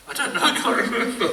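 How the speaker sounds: high, amused giggle through the words; laughing. Real, not acted. Telling friends about the trip, laughing at forgetting.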